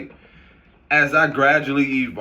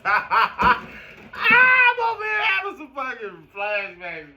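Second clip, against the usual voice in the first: high, slow laughing through words; laughing hard; 9 semitones higher, slower than usual. Real, not acted. Recalling grandma's teeth while laughing. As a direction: crack up mid-sentence.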